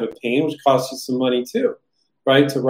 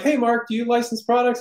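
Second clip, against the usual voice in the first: inventor asking; 8 semitones higher, 3 dB quieter than usual. Retold or imitated. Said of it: "And you're like".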